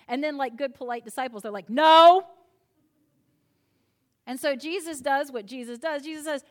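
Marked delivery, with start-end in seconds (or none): 1.7-2.3 blurted, much louder refusal; comic loud no; sudden loud no. Retold or imitated. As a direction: bark the no like a polite disciple giving up. Polite disciples answer Jesus.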